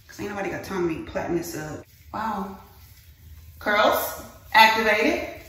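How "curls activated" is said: slow, louder mock announcement; playful hammed-up command; mock activation. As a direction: announce it like a superhero powering up.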